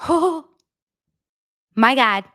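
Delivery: ordinary talk, nothing marked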